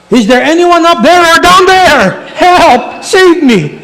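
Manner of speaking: high, loud comic yell for help; crying out. Acted. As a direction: holler up and down the cliff for any rescuer. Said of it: "then he cries out".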